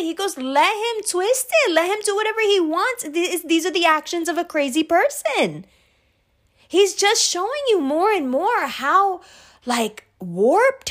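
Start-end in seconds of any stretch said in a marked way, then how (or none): 0.4-9.2 high, insistent imitation of dad; retold defiant outburst in a raised pitch; exasperated dad. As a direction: argue back as a fed-up father, drawing out the first words. Dad arguing back, exasperated.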